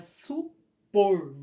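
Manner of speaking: supor with POR held long and loud; slow "supor", punch the final syllable; stress on last syllable. Shown as a demonstration. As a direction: split su-POR, leaning hard on "por". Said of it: "a última sílaba tônica é o por".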